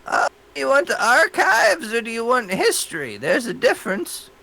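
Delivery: put-on high, lightly creaky old librarian; fussy old woman at the desk; old lady voice. Librarian voiced by game master.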